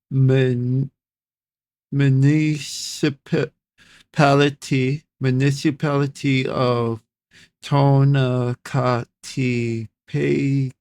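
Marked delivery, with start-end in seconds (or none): 0.1-5.0 MUNICIPALITY drawn out slowly, in halting pieces; sounding out. Real, not acted. Reader stumbling on a hard word, slowly sounding out municipality. 7.6-10.7 Tonacatepec very slowly, syllable by syllable; sounding out. Real, not acted. Reader tackling an unfamiliar Salvadoran place name, slowly sounding out Tonacatepec.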